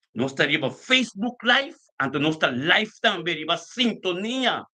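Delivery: ringing roll-call of live channels; live broadcast announcement. Real, not acted. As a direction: announce each platform with a lifted ending.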